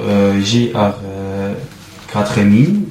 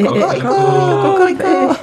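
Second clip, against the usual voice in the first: very high, drawn-out cockerel crow; imitated cock-a-doodle-doo; rooster crow; much slower than usual. Rooster joins the animal din.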